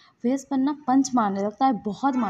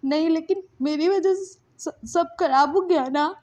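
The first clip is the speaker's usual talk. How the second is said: higher, halting guilty voice; holding back tears; 6 semitones higher, 3 dB louder than usual. Acted. Lansan blames themself to Wing, holding back tears. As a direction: stumble on the words, upset. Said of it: "बोलता है लांसन रोक के".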